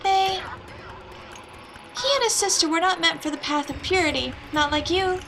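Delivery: mother startled at night, covering her worry; lightly voiced uneasy mother.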